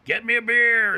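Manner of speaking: put-on gruff drunk father. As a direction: bark it like a drunk demanding his beer. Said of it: "he used to, you know".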